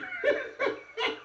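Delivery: real high burst of laughter; laughing at the neighbors' excuse. Real, not acted. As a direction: laugh at your own joke. Laughs at own story.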